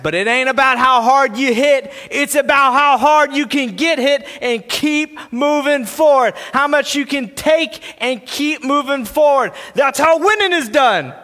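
rousing pep talk. Acted. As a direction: drive it home like a fighter's pep talk. Reading Rocky's pep talk aloud, building.

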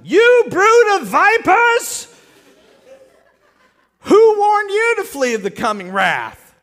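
high, drawn-out full-voiced shout; thundering rebuke. John greets the arriving crowds with a thundering rebuke. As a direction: bellow it as a preacher's accusation, slow and loud.

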